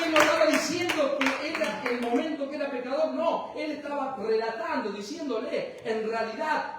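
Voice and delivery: loud, high, fervent preaching climax; preaching at full force. Acted. Pastor preaching to the congregation, rising to a fervent shout. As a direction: preach it out, building to a cry.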